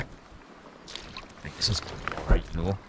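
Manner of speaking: soft, breathy whisper to himself; whispered aside. Real, not acted. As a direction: hush it, half to yourself. Quiet aside while landing fish.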